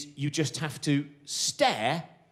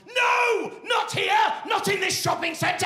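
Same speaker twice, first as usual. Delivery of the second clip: sudden high alarmed shout, much higher and louder; brain shouting no; 4 dB louder, slower than usual. Acted. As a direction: yell it like the brain slamming the brakes. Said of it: "your brain would have gone".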